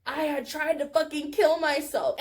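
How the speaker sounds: ordinary delivery, nothing marked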